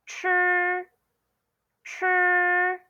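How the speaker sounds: "chi" curled-tongue, high and level; pronunciation model. Shown as a demonstration. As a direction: hold "chi" like a high, even "chr". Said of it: "you say".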